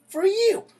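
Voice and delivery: high, loud shriek; screamed threat. Retold or imitated. To the old man, a final shrieked threat. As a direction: scream it in his face.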